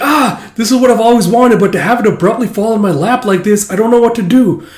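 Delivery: very high, loud gushing panic; overwhelmed fluster. Retold or imitated. Fiona unready for the wife role, overwhelmed mock panic. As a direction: spiral into hammed-up swooning.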